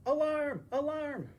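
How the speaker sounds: loud, high robotic alarm call. Acted. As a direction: blare it like a siren.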